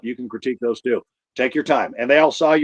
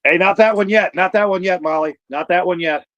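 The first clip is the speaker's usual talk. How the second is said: calling out; 6 semitones higher than usual. Real, not acted. Stopping the wrong clip.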